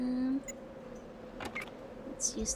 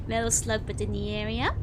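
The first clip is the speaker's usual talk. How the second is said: normal talking voice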